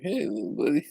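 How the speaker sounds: mock cute voice. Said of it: "She's like".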